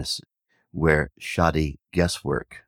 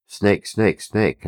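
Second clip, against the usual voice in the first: snake snake snake called out higher and louder; alarm cry; 6 semitones higher, 5 dB louder, slower than usual. Retold or imitated. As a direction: raise the alarm to the whole village. Villagers raising alarm about snake.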